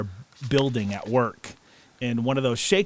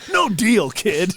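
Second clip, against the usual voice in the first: mock gruff shout; 7 semitones higher, 5 dB louder, slower than usual. Mimicking shop owner yelling at kids.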